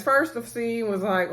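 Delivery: ordinary talk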